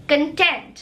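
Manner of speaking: कंटेंट as kun-TENT, weak first syllable; content meaning satisfied. Shown as a demonstration. Teacher models the word for satisfied, कंटेंट as kun-TENT. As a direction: stress the second syllable of कंटेंट. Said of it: "आप बहुत संतुष्ट हैं".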